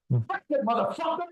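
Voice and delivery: high-pitched yell, held back in volume; retold yell. Fighter caught in an armlock daring his opponent, mock yell. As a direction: push it way up high without real loudness. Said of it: "I yell out".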